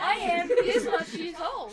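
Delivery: laughing. Real, not acted. Laughing slow exclamation.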